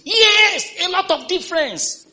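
high, loud pulpit proclamation; rising to a preacher's shout; preaching at full force. Real, not acted. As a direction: ring it out over the congregation.

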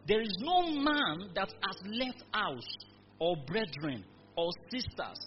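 loud, measured scripture read; preacher reading Bible verses, slow and loud. Acted. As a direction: proclaim the verses to the congregation. Preacher reads scripture aloud.

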